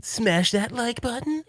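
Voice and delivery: very high, slow put-on YouTuber catchphrase; Mad Little Pixel. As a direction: draw it out high, rising at the end. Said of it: "I'm gonna do my impression".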